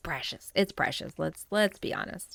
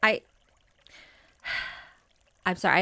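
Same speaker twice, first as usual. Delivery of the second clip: normal talking voice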